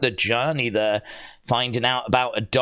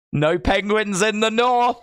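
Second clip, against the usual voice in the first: high, raised-voice mock declaration; mock exclamation; 6 semitones higher than usual. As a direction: call it out high, as a playful classroom bit. Acting out the geography teacher bit.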